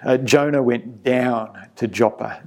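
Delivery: ordinary delivery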